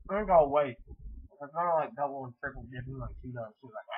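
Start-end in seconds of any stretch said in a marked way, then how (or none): 1.4-3.9 mouth full. Real, not acted. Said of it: "talking with my mouth full".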